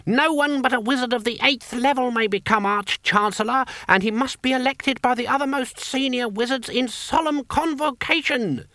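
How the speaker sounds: put-on very high-pitched elderly pedant; indignant, crisp. A narrator's reading. Elderly law lecturer objects to the nomination, indignantly. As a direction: recite the rules in precise outrage. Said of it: "clipped as topiary, crisp as biscuits".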